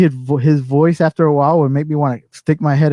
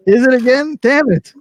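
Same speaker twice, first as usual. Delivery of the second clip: high, exasperated outburst; mock exasperation; 9 semitones higher, 4 dB louder than usual. Islander woken by the monthly song, mock exasperated. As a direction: complain with pitched-up annoyance. Acted annoyance at wake-up song.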